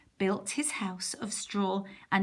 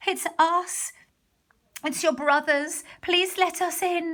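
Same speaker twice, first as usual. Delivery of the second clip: frightened pigs; 8 semitones higher, 4 dB louder than usual. A narrator's reading. Brothers beg breathless.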